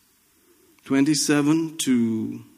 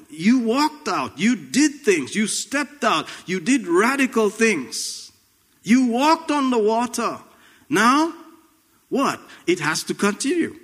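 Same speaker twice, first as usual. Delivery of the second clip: rising fervor; 8 semitones higher, slower than usual. Real, not acted. Preacher building up intensity.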